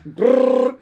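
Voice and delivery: imitated chain-punch burst, high and loud; loud rolled "brrr". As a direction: rattle off a punching noise.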